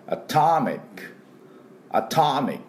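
atomic with an open, stressed "ター" in アトミック; English pronunciation model. Shown as a demonstration. Teacher models atomic for radio listeners, English pronunciation model. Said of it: "このターのところですね。これオの音です。".

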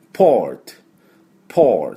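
"ポート" with tongue back and a final clean t; English "port". Shown as a demonstration. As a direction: model "ポート" as English port with r-coloured vowel. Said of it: "こんな感じで".

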